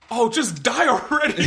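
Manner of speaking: high, drawn-out mock yell; imitated heckler's yell. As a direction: yell it like a fed-up moviegoer, stretching it out. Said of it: "Somebody just yelled at us".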